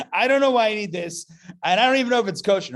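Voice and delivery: high, loud, rushed panic-buyer voice; mock panic shopper. Rabbi mocks himself grabbing things in a fast, loud fluster. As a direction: babble it out fast like a frantic shopper. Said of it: "I'm like".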